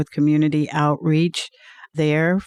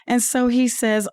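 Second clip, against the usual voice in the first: ordinary talk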